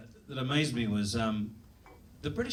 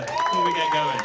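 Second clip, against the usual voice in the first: squeaky; 7 dB louder than usual. Acted. Comic squeaks the repeat.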